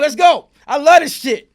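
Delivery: high, loud, fired-up challenge; retold taunting shout. Inmate daring attackers to continue, loud retold taunt. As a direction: egg them on at full volume.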